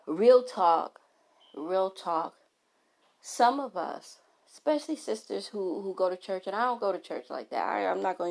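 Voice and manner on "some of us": ordinary talk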